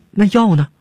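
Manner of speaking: high, anxious little question; urgent question. A narrator's reading. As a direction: press anxiously for an answer. Anxious follow-up question about the medicine. (急切地高声追问)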